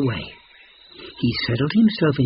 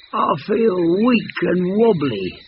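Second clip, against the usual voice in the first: fibbing lion; 6 semitones higher than usual. A narrator's reading. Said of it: "Lion fibbed".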